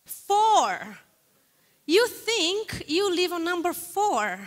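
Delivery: surprised echo. Real, not acted. Echoing the audience's answer.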